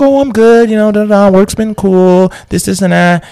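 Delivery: breezy, way up high chatter; drugged-up visitor making small talk, high and breezy; high spacey girl voice. Retold or imitated. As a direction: float the words up like a dazed girl. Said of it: "She's like".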